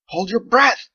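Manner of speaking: high, loud urgent shout. Acted. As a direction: warn everyone at full voice. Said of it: "Andolin shouted at them".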